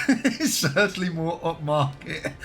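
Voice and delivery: chuckling through the words; amused, laughing it out. Real, not acted. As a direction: start to crack up mid-line. Laughs at a chat remark.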